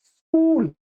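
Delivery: स्कूल said cleanly with s+k cluster, no added vowel; स्कूल, no vowel before the स; correct pronunciation. Shown as a demonstration. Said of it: "इसका सही उच्चारण है". ("स्कूल" बिना किसी स्वर के, सीधा "स्क" से शुरू)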